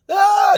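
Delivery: high loud imitated wail; wailing cry. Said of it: "just crying out".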